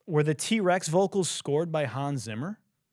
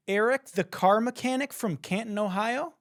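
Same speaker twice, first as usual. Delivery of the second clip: higher, incredulous rise on Eric and Ohio; sarcastic mocking; 9 semitones higher than usual. Mocking a know-it-all commenter, sarcastic and incredulous. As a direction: sneer the name and town as a question.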